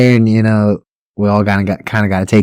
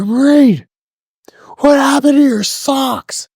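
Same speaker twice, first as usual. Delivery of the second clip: high, slow mocking drill sergeant; drill instructor mockery; slower than usual. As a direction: sneer at the recruit's socks.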